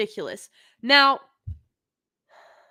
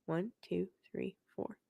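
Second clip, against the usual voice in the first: quiet counting; 4 semitones lower, 15 dB quieter than usual. Real, not acted.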